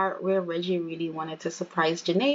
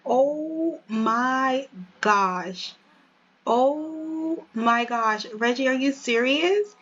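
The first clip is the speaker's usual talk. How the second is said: slow, drawn-out, higher-pitched amazement; stunned disbelief; 5 semitones higher, slower than usual. Acted. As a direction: act overwhelmed by a proposal. Girlfriend reacting to marriage proposal.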